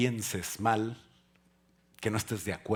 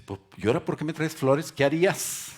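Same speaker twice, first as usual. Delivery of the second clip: suspicious wife; 6 semitones higher, 8 dB louder than usual. Retold or imitated.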